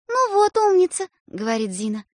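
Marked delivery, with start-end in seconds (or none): 0.1-1.1 high, approving; pleased praise. A narrator's reading. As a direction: praise the good boy. Sister praises.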